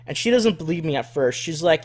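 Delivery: ordinary delivery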